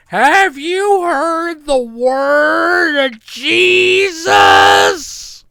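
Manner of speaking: high, slow, loud sing-song preacher call; hammed-up proselytizer shouting through the door. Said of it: "knocking at your door going like".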